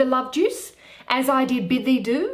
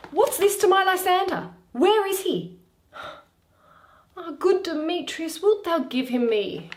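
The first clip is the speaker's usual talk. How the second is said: put-on higher, urgent young woman demanding answers; anxious Hermia; 9 semitones higher than usual. Hermia pressing Demetrius about Lysander, high and urgent. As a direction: ask for Lysander as a frantic Hermia. Hermia's lines in read play.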